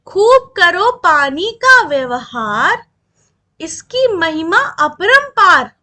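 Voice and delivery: poem recitation. Acted. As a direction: recite the fruit list slowly and clearly to the class. (धीमी, ज़ोरदार लय में कविता पाठ)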